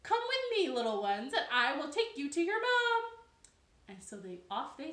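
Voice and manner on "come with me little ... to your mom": high, loud, bossy chirp; bird voice. Acted. Cheep Cheep leading the little ones home, brisk. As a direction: lead them off briskly. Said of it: "And the bird said".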